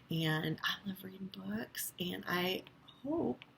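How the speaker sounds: soft unvoiced whisper. Real, not acted. Teacher whispers goodbye to her kindergarten class. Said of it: "whispered goodbye".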